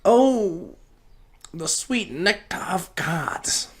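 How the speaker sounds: high breathy rapture over the sweet nectar; hammed-up savoring. Podcaster after a weed hit, in mock rapture. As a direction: swoon over it like a nobleman tasting wine.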